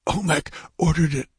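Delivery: high, gasping dying man. Acted. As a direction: gasp it out through the pain. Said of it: "gasped the dying man".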